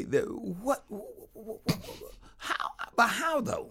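baffled. Real, not acted. Baffled stammering question.

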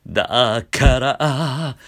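loud da slid up from fry; scooped da. Shown as a demonstration. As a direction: scoop each da up. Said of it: "しゃくって音程をつけて".

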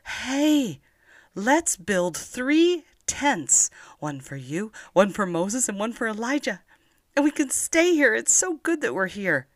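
high, eager excited voice. Acted. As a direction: burst out with excitement. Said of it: "they were so excited".

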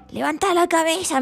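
a friend's mock yell from the audience. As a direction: shout it up at the stage. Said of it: "me gritaba".

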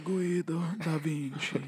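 high, slow mock Italian accent. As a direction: draw it out in hammed-up Italian. Mock Italian name performed for laughs.

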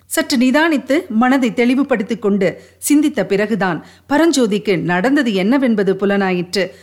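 ordinary delivery, nothing marked

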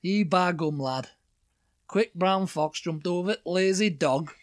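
drawn-out, caricature Yorkshire; Yorkshire accent. Shown as a demonstration. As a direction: lean slowly into broad Yorkshire. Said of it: "Well, Yorkshire, the next one".

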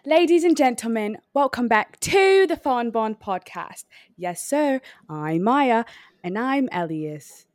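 showy British podcast welcome; British commercial host. Acted. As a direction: announce it like a British reality show host. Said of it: "It was giving very, uh, commercial".

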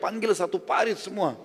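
high, slow retold call; mock calling out, pitched well up; retold shout. Speaker retells the summons from the rock, retold shout. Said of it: "di atas batu teriak".

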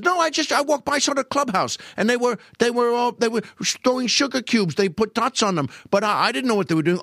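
put-on high, stammering innocent boy; Lou Costello impression. Accused gambler feigns innocence about dice, high and boyish. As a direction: babble the excuse in a high kid voice. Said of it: "And then Lou says".

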